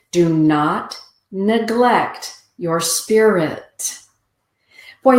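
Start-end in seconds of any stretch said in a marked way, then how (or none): 0.1-4.0 slow oracle words. Pretend. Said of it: "the Sleipnir says".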